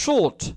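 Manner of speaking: short said with lips rounded into o; British short with round o. Shown as a demonstration. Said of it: "মুখটা অনেকটা গোল করে ও বলবে".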